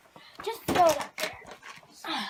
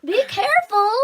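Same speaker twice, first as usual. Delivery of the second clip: loud, urgent warning call; 2 semitones higher, 14 dB louder than usual. Real, not acted. Warning a friend in the cave.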